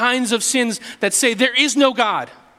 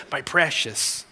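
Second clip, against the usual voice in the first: a lightly voiced Gollum, low and soft; a hint of Gollum clutching his ring; Gollum's my precious; 4 semitones lower, 4 dB quieter, slower than usual. Preacher briefly imitating Gollum, lightly voiced, low and soft. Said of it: "the one who says".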